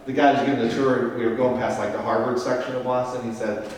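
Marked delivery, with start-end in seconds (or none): none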